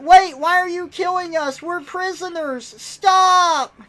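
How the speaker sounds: high, loud mock-panicked plea; panicked protest. Prisoner in an audio log being attacked, hammed-up panic. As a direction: beg them to stop, rising to a yell.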